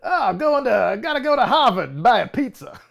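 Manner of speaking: high, hammed-up Boston accent; mock Boston accent. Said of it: "my Boston accent".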